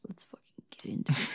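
quick hushed whisper; eager whisper. Acted. Co-host agreeing to dive into the episode, eager whisper. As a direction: whisper it fast, like a secret go-ahead.

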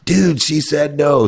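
high, slow schoolboy call-out; mock yell. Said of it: "and he screams out".

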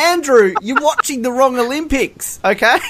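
high-pitched, laughing exasperation; mock exasperation. Podcaster mock-scolds commentator for his mistake, exasperated. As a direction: scold in disbelief, voice pitched right up.

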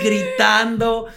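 a drawn-out excited yell; mock shout. To his empty little office, a drawn-out yell. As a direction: shout it slow, thrilled. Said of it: "corro y grito".

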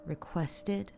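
barely audible inner voice; half-whispered to himself; hushed thought. A narrator's reading. Word echoing in his mind.

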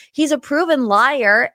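ordinary talk

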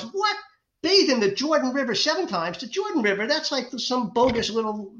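high, incredulous outburst at bathing in the Jordan; acting out an outraged warrior; furious disbelief. Naaman, insulted by the prophet, shouts in high outraged disbelief. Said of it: "Naaman is furious".

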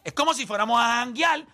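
normal talking voice

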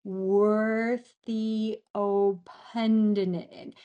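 "Worthy opendin-" very slow, drawn out and stumbling; struggling to pronounce. Shown as a demonstration. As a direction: labour through "Worthy opendin-", losing the end of the second word. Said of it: "I can't even say it yet".

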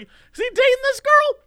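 very high-pitched incredulous question. Retold or imitated. Telling friend his first reaction to the video, incredulous. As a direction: squeal it in mock disbelief.

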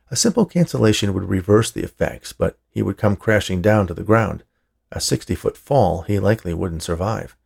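ordinary delivery

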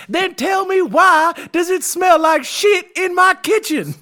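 very high, loud, slow, breaking into laughter; head chef raging at his cooks over the smell; furious chef shout. Pretend. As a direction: demand it at full volume. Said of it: "He goes".

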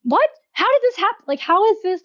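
sudden disbelief, voice jumping up; shocked confusion. Retold or imitated. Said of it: "And, like".

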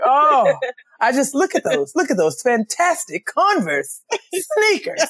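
high, slow, grand praise of the sneakers; hammed-up Talley admiring Converse; mock Talley gushing. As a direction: swoon over the sneakers like a fashion legend. Voicing Talley complimenting sneakers.

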